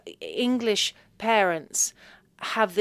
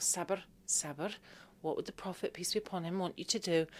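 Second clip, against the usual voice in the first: much softer inner self-talk; 11 dB quieter than usual. Retold or imitated. Daughter calming herself, much softer. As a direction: talk yourself down under your breath.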